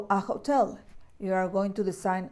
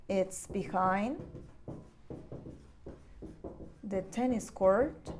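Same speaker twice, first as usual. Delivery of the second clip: "It's behind ... the tennis court" said slowly and softly, with a pause after "behind"; slow and soft, broken into chunks for learners; slow modelling; 7 dB quieter, slower than usual. Shown as a demonstration. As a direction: spell it out slowly and softly for the class.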